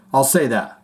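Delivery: ordinary talk, nothing marked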